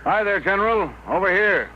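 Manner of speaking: high-pitched hail to an arrival; calling out. Acted. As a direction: call over to a newcomer. Barnes hails the arriving General.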